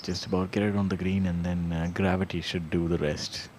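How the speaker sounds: live golf play-by-play, conversational; golf commentator. Real, not acted. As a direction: call the putt like a TV golf commentator.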